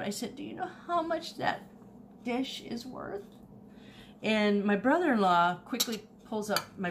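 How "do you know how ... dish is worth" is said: incredulous. Retold or imitated. Retold astonished question.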